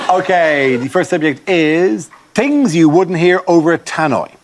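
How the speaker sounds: loud, brisk game show host; host announcement. Acted. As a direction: announce the round to the room. Host introduces the round.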